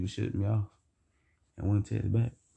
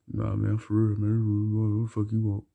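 mock boy voice. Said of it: "acting like she was a boy".

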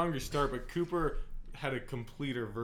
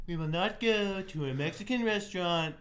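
higher, bossy Trump voice; Trump impression; 7 semitones higher, 3 dB louder than usual. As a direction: boom it out like Trump. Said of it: "I was expecting, like".

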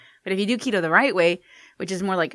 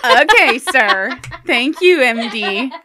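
loud mock-grateful sarcasm; sarcastic; 7 semitones higher, 8 dB louder, much slower than usual. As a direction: thank him with heavy sarcasm. Sarcastic reply to the doctor.

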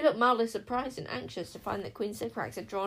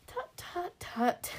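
light disapproving tut-tut; tutting; 10 semitones higher, much slower than usual. A narrator's reading. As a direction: scold gently with clicks. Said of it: "tuttered Perdita".

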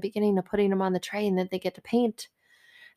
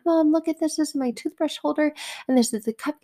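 excited daughter; 7 semitones higher than usual. Retold or imitated. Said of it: "she's like".

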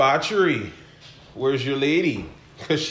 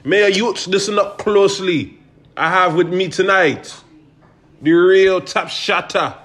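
slow, raised hype-man intro; announcer intro; 5 semitones higher, 3 dB louder than usual. Acted. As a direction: announce like a late-night host. Podcast host intro voice.

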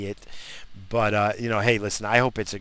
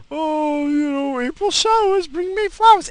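high, loud mocking whine; 7 dB louder than usual. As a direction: sneer it out in a high voice. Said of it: "It's February, jackass".

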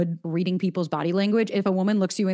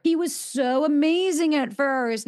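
high, slow swooning quote; dreamy mock gush; 6 semitones higher, slower than usual. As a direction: gush it like a smitten dater. Mocking clients' naive claim.

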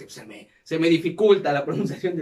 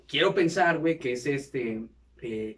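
ordinary delivery

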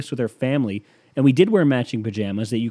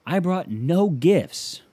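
mock cheerful arrival; 8 semitones higher, slower than usual. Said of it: "show up and be like".

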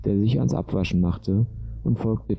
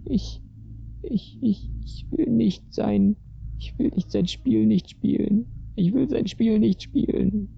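put-on high, stammering child's wail; sobbing little girl; 14 semitones higher than usual. A narrator's reading. Crying girl refusing his game, high sobbing pleas. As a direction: plead tearfully, very high, stumbling over the words. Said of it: "begraf das Mädchen schluchzend heraus".

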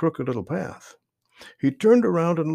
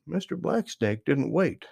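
ordinary delivery, nothing marked

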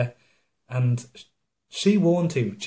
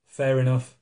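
ordinary delivery

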